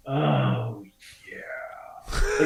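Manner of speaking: breathy, voiceless over-the-top moan. Shown as a demonstration. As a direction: moan it indulgently. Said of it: "huge difference between".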